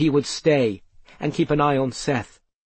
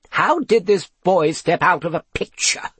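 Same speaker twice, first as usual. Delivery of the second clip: louder, higher, demanding professor; 4 semitones higher, 3 dB louder than usual. A narrator's reading. Professor questions how the boy left the picture, demanding. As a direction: demand an explanation. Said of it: "demanded Professor Papaspook".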